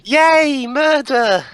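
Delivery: high, hammed-up hooray; loud, squealed mock celebration; mock cheer. Mock cheering the game killing.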